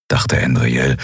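plain reading voice

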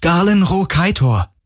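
high-pitched, pleading call; urgent calling. A narrator's reading. Galen begs the spirit to remember him, calling out. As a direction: call out your name to be remembered. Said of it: "rief er laut".

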